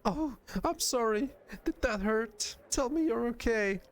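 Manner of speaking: very high, anxious apology; high little character voice, worried; frightened concern. Acted. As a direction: fuss over someone you fear you hurt. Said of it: "frightened she hurt you".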